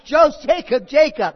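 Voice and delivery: loud call, fumbling the first name. Acted. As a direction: shout the name like a parent who slipped. God calling, playing parent mix-up.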